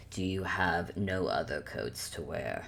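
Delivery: low, soft, resigned character voice; Antler, sighing. Acted. Antler asking Ruby about other coats, low and sighing. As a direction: ask it low and quiet, after a sigh. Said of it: "sighs and goes".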